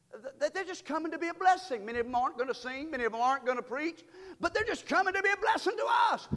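quick, rising preaching run; building preaching. Acted. Pastor rallying church about visitors, rising to a shout.

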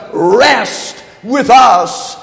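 very slow, each word stretched; drawn-out emphasis. Acted. Preacher lingering on the verse, very slow. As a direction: savour "rest with us" slowly. Repeating key verse slowly.

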